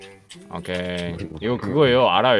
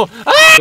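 very high, short yelp; startled yelp; 4 dB louder than usual. Real, not acted. Player caught off guard in game, startled yelp. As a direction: yelp out in sudden shock.

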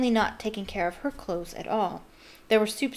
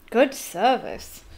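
slow, drawn-out admiration; impressed; 2 semitones higher, 3 dB louder, much slower than usual. A narrator's reading. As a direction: stretch the words, clearly impressed. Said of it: "Ron said in an impressed voice".

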